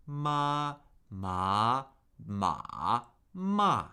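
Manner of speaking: cycle "ma" through the four tones in order; drilled "ma" in four distinct pitch shapes; four Mandarin tones. Shown as a demonstration. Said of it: "cycle this syllable through the four tones".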